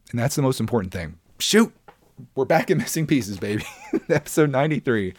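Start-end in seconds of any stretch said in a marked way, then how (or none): 1.4-1.7 sharp high yelp of surprise; sudden exclamation. Real, not acted. Breaking off his pep talk to viewers with a startled, loud yelp. Realizes he got carried away. 2.3-5.1 laughing. Real, not acted. Laughs while restarting the show intro.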